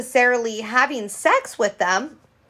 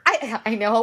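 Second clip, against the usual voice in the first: ordinary talk, nothing marked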